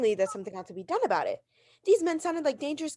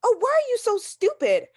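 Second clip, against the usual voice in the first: furious outburst; 10 semitones higher, 6 dB louder than usual. Acted. Exasperated woman snaps at operator.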